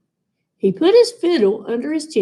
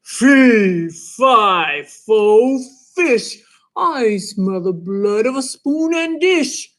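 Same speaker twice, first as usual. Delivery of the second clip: a hint of a booming giant; slower than usual. A narrator's reading. Giant chanting from far away. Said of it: "a voice boomed".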